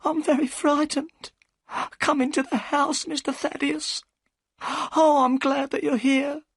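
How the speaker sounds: put-on very high, trembling old woman's voice; a scared old servant pleading; frightened housekeeper. A narrator's reading.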